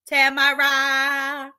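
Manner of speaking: Tamara drawn out very slowly as TEH-my-rah; savoring the name. Shown as a demonstration. Host showing chat how smoothly the name rolls, slow and savoring. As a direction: roll "Tamara" off the tongue slowly as TEH-my-rah, enjoying each sound. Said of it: "See how good that go?".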